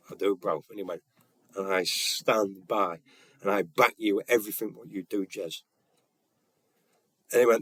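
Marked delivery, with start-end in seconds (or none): 0.1-5.6 subdued, heartfelt imitation; quietly emotional. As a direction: imitate him speaking through mild emotion, measured. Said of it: "he had a little tear in his eyes".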